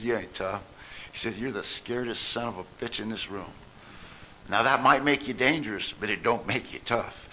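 low, quiet imitation of a gravelly old man; deep gravelly old-timer. Old-timer telling off a tough kid, low and quiet. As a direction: lean in and growl it low and soft. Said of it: "the deepest, graveliest voice".

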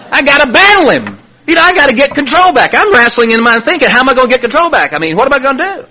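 high-pitched, agitated self-argument; worked-up fretting. Retold or imitated. Father plotting to get control back, worked-up fretting. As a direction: replay a frantic inner debate. Acting his frantic inner argument.

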